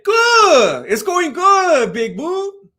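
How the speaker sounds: high, loud, drawn-out happy shout; put-on cheer. Telling a viewer all is well, put-on cheer. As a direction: holler it slowly with exaggerated cheer.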